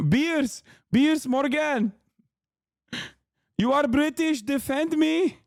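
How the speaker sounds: put-on high, pleading parody with an Arabic accent; mock Arabic accent. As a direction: ham up an Arabic-accented appeal for help. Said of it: "He just sounds like that".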